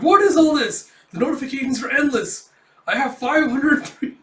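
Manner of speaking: hammed-up shock; shocked disbelief. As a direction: freak out at the pile of messages. Kafka shocked by endless notifications.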